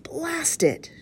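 high-pitched villain's curse; frustrated cry. A narrator's reading. Blind man learns the map is missing, crying out. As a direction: cry out in vexation. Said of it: "cried the blind man".

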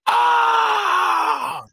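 a shoulder popping out, cry it out; imitated pained scream. Guy dislocating his shoulder at the punching machine, pained scream. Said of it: "and I just hear".

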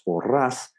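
porras with a clean final a; clear final vowel. Shown as a demonstration. Coach showing the contrasting word, porras with a clear a. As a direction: say the a of porras distinctly, unblurred. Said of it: "but it's still very clear".

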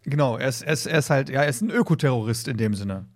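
ordinary delivery

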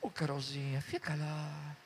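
low, soft, drawn-out coaxing; softly retold fatherly plea; tender reassurance. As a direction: gently coax her, pitched low and soft. Retells tender advice to Carol.

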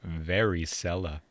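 "Varicella" slow, "cella" leaned on like cellar; punchline delivery. Shown as a demonstration. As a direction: drag out "Varicella" to land the pun. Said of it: "Like cellar".

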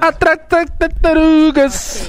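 high, very drawn-out arcade call; video game attack cry. Acted. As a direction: mimic a garbled fighter's special move. Repeating game cry on demonstration.

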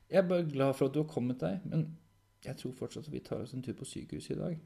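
reassure, then gently insist; concerned caretaker. Acted. Caretaker urging Henrik towards a hospital visit, mildly concerned.

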